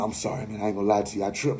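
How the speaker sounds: low, soft mumbled apology; sheepish confession. Retold or imitated. Friend admits he tripped, quiet and sheepish. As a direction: own up quietly, a bit ashamed.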